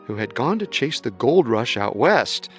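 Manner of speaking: ordinary delivery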